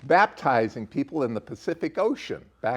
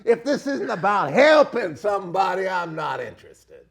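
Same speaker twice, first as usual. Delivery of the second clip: laughing, shouted preaching; rising impassioned oratory; 6 semitones higher, 4 dB louder than usual. Real, not acted. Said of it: "Do I sound like a preacher there?".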